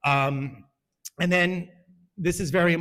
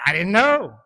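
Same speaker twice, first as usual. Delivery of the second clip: defensive protest; 3 semitones higher, 9 dB louder than usual. Retold or imitated. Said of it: "I'm like".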